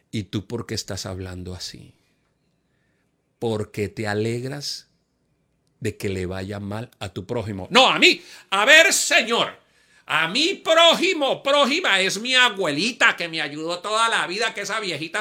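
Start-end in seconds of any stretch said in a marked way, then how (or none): 0.1-7.7 low, soft voice of conscience; a lower, softer Holy Spirit gently rebuking; gentle rebuke. Acted. 7.7-14.3 high, loud indignant reply to God; indignant protest. Acted. Arguing back with the Spirit.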